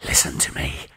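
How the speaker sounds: low urgent whisper. Acted. Tillinghast drawing his friend close, whispered. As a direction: breathe it, conspiratorial.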